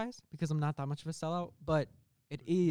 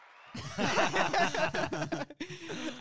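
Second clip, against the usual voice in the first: long, high, loud evil laugh; hammed-up villain laughter; demon cackle; 6 dB louder than usual. Demon laughs after his proclamation.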